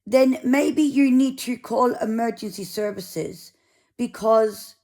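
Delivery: a mild attempt at a South African accent; half-hearted South African accent impression; lightly mimicked South African volunteer. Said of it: "I'll try and do the accent".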